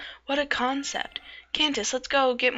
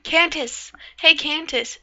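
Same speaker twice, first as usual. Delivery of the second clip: raised voice calling her name; 6 semitones higher, 4 dB louder, slower than usual. Acted. Friend calling out to a distracted Candace, loud. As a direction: call out to get her attention.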